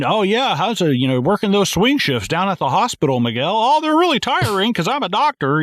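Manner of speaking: high, singsong fake chitchat; hammy, heavy-handed exposition voice; mock small talk.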